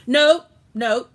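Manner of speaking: loud, quick cut-off to stop him; urgent interrupting nope. A narrator's reading. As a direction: blurt it out to cut him short. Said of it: "my mother tried to stop him".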